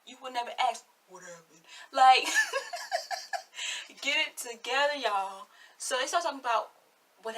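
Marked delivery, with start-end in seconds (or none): 1.1-1.6 very soft, deep-voiced mimicry; mock-deep, barely voiced imitation. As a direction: sink low and hushed to mock her deep voice. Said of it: "your deep voice ass".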